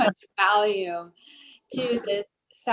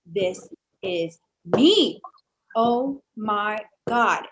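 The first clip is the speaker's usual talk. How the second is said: slow, louder gasp of recognition; stunned realization; 5 dB louder, much slower than usual. Retold or imitated. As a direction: let it land slowly, awestruck. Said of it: "and I was like".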